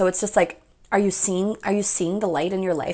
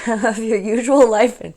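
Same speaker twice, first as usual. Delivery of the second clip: laughing; 7 dB louder than usual. Real, not acted. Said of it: "I'm just laughing so hard".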